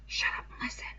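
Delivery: urgent whisper. A narrator's reading. Ralph hushing Piggy near a prowler, urgent whisper. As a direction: hiss it to keep hidden.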